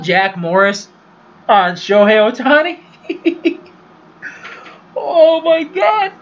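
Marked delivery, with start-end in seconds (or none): 1.5-6.1 high laughing disbelief, drawn out; incredulous laughing. Real, not acted. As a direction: crack up, slowly stretching the words. Cracking up at an awkward story.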